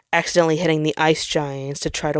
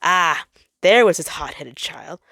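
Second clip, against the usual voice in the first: ordinary delivery, nothing marked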